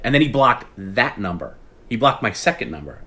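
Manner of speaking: normal talking voice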